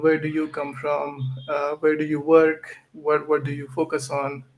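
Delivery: normal talking voice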